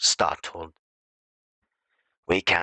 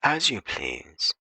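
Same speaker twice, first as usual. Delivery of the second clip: slow, higher, gently sincere; mean it kindly, slowly; a husband sincerely agreeing; 4 semitones higher, slower than usual. Shown as a demonstration. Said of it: "quite sincerely".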